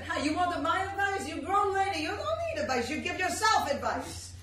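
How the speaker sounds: fatherly West Indian pep talk; West Indian accent. Retold or imitated. Cab driver advising his passenger, West Indian accent. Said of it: "as the cab driver said".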